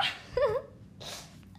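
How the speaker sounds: airy laugh; breathy laugh. Real, not acted. Laughs after address.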